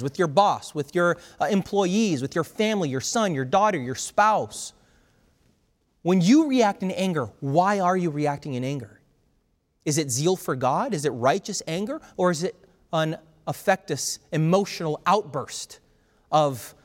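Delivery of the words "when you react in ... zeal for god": ordinary delivery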